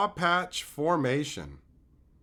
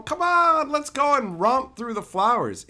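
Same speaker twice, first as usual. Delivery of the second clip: cheerful rabbit voice; 8 semitones higher, 6 dB louder than usual. Retold or imitated. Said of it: "You got this rabbit going".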